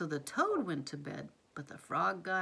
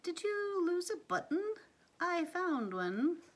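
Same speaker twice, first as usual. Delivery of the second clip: little sparrow voice; 10 semitones higher than usual. Acted. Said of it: "said the sparrow".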